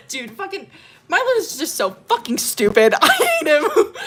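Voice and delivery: loud, high, laughing outburst; yelling through laughter at a fictional guy; laughing rant. Real, not acted. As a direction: rant at him while cracking up.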